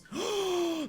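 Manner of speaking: sharp high gasp of delight. Real, not acted. Spotting a new lion cub, a delighted gasp.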